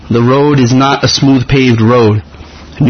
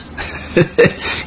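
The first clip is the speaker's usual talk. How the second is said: breathy laugh; 4 semitones higher, 7 dB quieter than usual. Real, not acted. Laughs at being a kid at heart.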